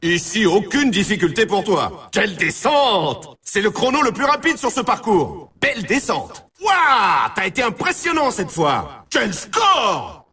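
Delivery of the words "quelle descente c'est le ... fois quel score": high, excited whoops of praise; thrilled cheering. Acted. Game announcer to player with top score, thrilled cheering. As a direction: hype the player up, stretching the exclamations.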